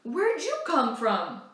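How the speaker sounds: put-on a boy caught off guard by a newcomer; surprised stranger. Stranger finding Echo on the farm, slow surprised question.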